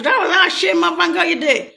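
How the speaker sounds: mock shout.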